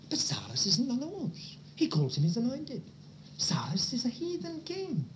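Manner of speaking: preacher whispering a surprising point; hushed aside. Real, not acted. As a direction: confide it in a whisper.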